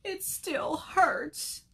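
high, drawn-out whimper; hurt little bird still crying; crying complaint. A narrator's reading. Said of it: "Bird kept crying".

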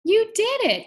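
put-on high, loud cheer for the kids; excited praise. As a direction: celebrate their success out loud. Praising children after counting.

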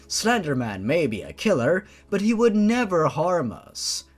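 ordinary delivery, nothing marked

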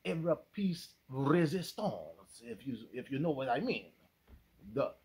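put-on French accent. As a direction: play it like a cheesy Frenchman hosting an intermission. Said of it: "That's my French accent".

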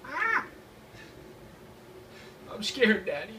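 put-on high squeal then whiny kid plea; scared little kid. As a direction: whimper like a frightened child. Repeats child bit.

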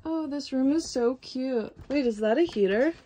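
usual speaking voice

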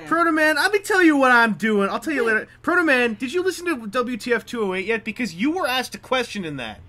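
loud, high, laughing call to Proto Man; loud teasing call-out. Real, not acted. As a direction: call him out loudly across the room, teasingly. Teasingly calling out a friend.

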